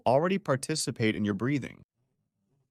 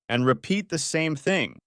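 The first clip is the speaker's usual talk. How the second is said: normal talking voice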